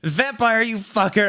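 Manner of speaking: high, outraged exclamation; hammed-up outrage; indignant outburst. A narrator's reading. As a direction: scold him in a high voice. Indignant line while slapping someone.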